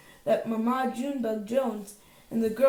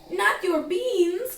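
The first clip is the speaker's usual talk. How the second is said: high, slow outraged protest; outraged cry; 9 semitones higher, 5 dB louder, much slower than usual. A narrator's reading. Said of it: "cried Scipito, outraged".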